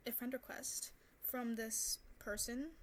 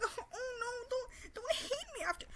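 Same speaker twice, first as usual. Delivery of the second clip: much higher, whiny fake pleading; mock pleading; 13 semitones higher than usual. Mocking how he thought she'd beg. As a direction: ham up a desperate plea.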